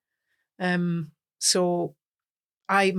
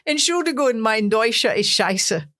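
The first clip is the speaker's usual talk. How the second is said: put-on careful stage German accent; best German accent; 3 semitones higher, 4 dB louder than usual. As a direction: proudly announce it in Scottish-tinged German. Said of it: "in my, my best German accent".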